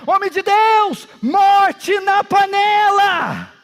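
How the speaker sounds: high, loud cry with long drawn-out words; preacher acting out a panicked yell; alarmed shout. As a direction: cry out a warning to everyone. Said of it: "De repente alguém grita".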